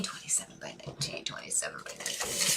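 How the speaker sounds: quick whispered repetition while thinking; whispering to herself. Real, not acted. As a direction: mull it over under your breath, barely audible. Repeats figures to herself while thinking.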